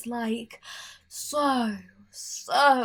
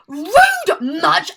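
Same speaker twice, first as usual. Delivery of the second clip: loud, mock-outraged gasp and cry; offended gasp and shout; 7 semitones higher, 15 dB louder than usual. Famine gasping in outrage at Alex's insult. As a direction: play the wounded diva.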